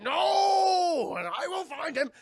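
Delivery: high, loud crying yell of no; anguished shout. Retold or imitated. Zod crying out as the ship leaves, high loud anguished shout. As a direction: scream it in despair. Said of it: "Zod's like".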